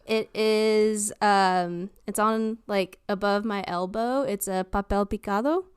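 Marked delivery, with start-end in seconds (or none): none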